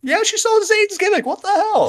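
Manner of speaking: high squeaky cartoon voice; squeaky mock voice. Echoing in a put-on voice.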